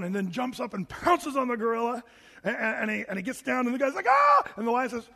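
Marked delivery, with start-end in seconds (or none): 4.1-4.4 loud, high-pitched mock scream; short hammed-up shriek of terror; terrified scream. Man pinned down by the lion, mock terrified scream.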